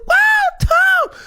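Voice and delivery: high-pitched, loud shout of the name; cheering crowd. Retold or imitated. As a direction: shriek it like a wild studio audience. Voicing the crowd going wild.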